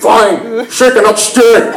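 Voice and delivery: reluctant slushy "shtirred"; slow, lisping imitation of brother giving in; brother's slurred S. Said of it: "the way he says his S's".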